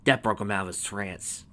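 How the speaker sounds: plain reading voice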